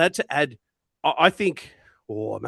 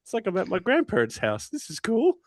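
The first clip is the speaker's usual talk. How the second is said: delighted; 7 semitones higher than usual. Retold or imitated. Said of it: "I was like".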